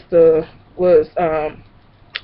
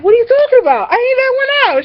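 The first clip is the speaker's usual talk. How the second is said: much higher, fake-innocent denial; mock innocent; faster than usual. Denying the fart to her cousin, mock innocent. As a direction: play dumb, high and squeaky. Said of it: "I was like".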